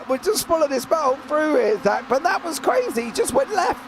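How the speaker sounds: ordinary talk, nothing marked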